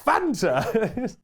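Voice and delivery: high, loud mock amazement; hammed-up delight, drawing out the last words; very enthusiastic. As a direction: marvel like an absurdly overkeen customer. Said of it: "he's very enthusiastic".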